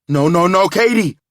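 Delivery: loud, high, desperate cry; desperate shout. Acted. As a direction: shout before she hangs up. Line going dead.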